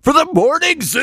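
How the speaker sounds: high hyped morning radio call. Pretend. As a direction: pitch it like a wacky DJ. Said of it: "press one for a normal voicemail".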